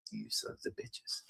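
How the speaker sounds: a threat whispered through the teeth; menacing whisper. Retold or imitated. Houthis eyeing the Saudis, a voiceless menacing whisper. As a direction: hiss it under the breath, voiceless. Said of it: "the Houthis are always looking at the Saudis like".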